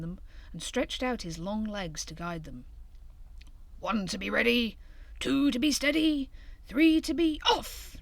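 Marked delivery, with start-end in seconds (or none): 3.8-8.0 excited countdown to go; building countdown. A narrator's reading. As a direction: build to the launch. Hare counting off the sledge.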